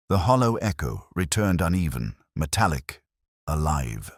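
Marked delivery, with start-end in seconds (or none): none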